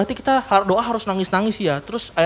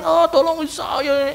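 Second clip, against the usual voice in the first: mock pleading; 8 semitones higher, much slower than usual. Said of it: "nangis sama orang lain".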